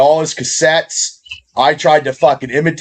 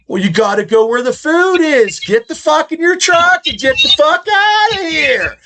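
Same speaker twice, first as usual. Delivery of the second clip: screaming comic impression, pitch climbing very high; high screamed rant; Sam Kinison; 13 semitones higher than usual. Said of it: "He screamed".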